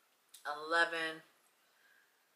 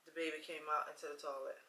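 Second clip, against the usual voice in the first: quiet, heavy recollection, trailing into silence; hushed, sombre; 11 dB quieter than usual. Real, not acted. Woman recalling her miscarriage and her late mom, hushed. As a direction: drop to a much softer voice with long pauses.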